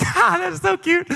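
put-on high cooing over a cute kid. Dad cooing over his pouting son, high and doting. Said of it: "It's funny to me".

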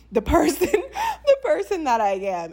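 laughter breaking into tears; laughing, choked up. Real, not acted. Telling viewers her chart shows who she is, laughing and choked up.

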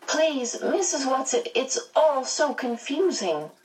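high, bewildered pleading; plaintive. A narrator's reading. Meg appealing to Missus Watson, in a high, plaintive voice. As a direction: beg for it to make sense, pitched high. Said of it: "Meg asked plaintively".

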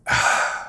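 long audible exhale; deep breath out. Retold or imitated. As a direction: breathe out fully, demonstrating a calming breath. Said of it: "Take a deep breath".

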